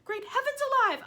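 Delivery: high, loud yell; shrieking aunt yell. Acted. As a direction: bellow it in amazement. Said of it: "Aunt Spiker sh- yelled".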